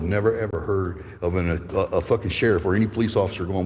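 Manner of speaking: normal talking voice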